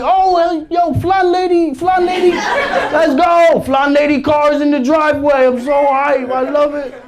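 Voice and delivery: put-on high, loud, hyped kid voice; a little boy yelling with joy; mock childish glee; excited kid. Kid excited about grandma visiting.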